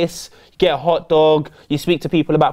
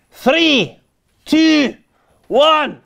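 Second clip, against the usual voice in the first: countdown hype; 8 semitones higher, much slower than usual. Acted. Countdown to quick fire round.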